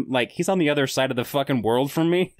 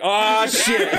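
mock surprise; 8 semitones higher, 4 dB louder than usual. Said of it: "I was like".

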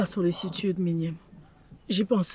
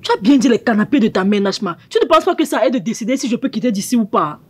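loud, raised-pitch comeback; angry retort; 3 semitones higher, 7 dB louder than usual. Acted. Visitor refusing to be ordered off the mother's sofa, angry and loud. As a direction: fire back forcefully in a quarrel.

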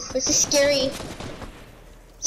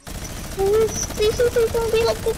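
high begging; 8 semitones higher than usual. Real, not acted. Begging enemy while under fire.

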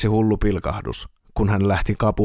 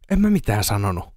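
ordinary delivery

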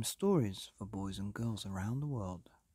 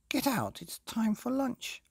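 much higher queenly call; queen's voice; 14 semitones higher, 4 dB louder than usual. Acted. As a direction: sing it out as a fussy queen. Queen's line.

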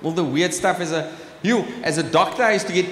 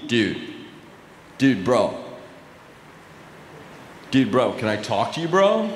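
low-pitched bro voice, rising at the end; American dude; 8 semitones lower than usual. Acted. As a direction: imitate a dude-bro approaching after the show. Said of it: "I had a guy come up to me".

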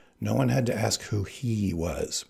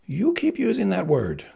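high-pitched, irritated Spaniard; snapped retort; 10 semitones higher than usual. A narrator's reading. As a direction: snap back at him in a raised pitch. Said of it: "Spaniard snapped".

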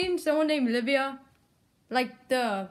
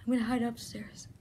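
whispering while hiding; 7 dB quieter than usual. Real, not acted. Choosing a hiding spot secretly.

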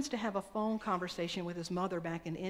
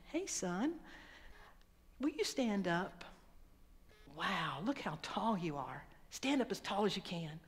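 gentle father; 5 dB quieter than usual. Retold or imitated. Father speaking gently to son.

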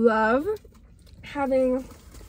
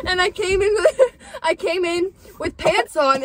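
laughing storytelling; 8 semitones higher, 6 dB louder than usual. Real, not acted. Laughing while telling embarrassing story.

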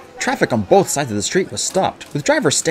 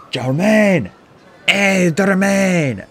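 higher, drawn-out call; shouting a name; 8 semitones higher, 4 dB louder, much slower than usual. A narrator's reading. Fans calling to a musician from the road, shouting. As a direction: hail someone across traffic. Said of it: "shouting his name".

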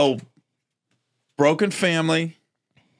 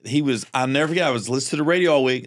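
normal talking voice

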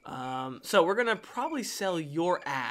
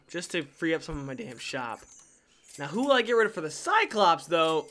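ordinary talk, nothing marked

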